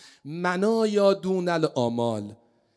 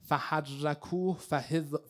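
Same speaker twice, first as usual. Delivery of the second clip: ordinary talk, nothing marked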